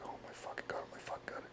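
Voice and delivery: barely audible whispered panic; nervous whisper. Retold or imitated. Trainee hearing his color called, nervous whisper. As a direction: whisper it to yourself in disbelief. Retold nerves as name is called.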